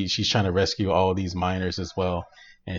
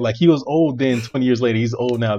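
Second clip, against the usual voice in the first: loud, high insistence; animated argument, building louder; heated rant; 4 semitones higher, 8 dB louder than usual. Real, not acted. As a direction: hammer home the point. Arguing heatedly with co-host.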